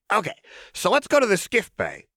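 put-on high, sing-song playful girl; high chirpy menace; manic Jinx voice. A narrator's reading. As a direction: tease the hostage brightly.

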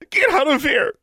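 high, loud shriek; screaming. A narrator's reading. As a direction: scream it at them. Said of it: "the fat teenage girl screamed".

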